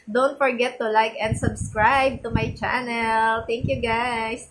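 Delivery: normal talking voice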